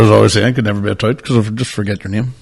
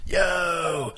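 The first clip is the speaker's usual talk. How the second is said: high, drawn-out crowd-rousing calls; mock stage hype, pitched well up; hyping a crowd; 7 semitones higher, slower than usual. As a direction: get the room going, retold at moderate volume. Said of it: "trying to get the energy going".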